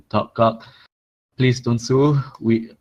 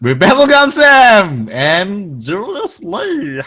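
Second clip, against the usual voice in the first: slow, high, drawn-out show announcer; grand announcer; 9 semitones higher, 6 dB louder, much slower than usual. Acted. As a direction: boom the show's name like a host. Announcing podcast name grandly.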